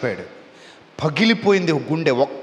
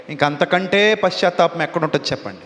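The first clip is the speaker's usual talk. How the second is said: normal talking voice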